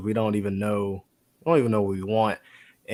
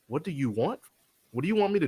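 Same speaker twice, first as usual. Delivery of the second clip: Jesus asking; 6 semitones higher, 4 dB quieter, faster than usual. Retold or imitated. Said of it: "looks at the man and goes".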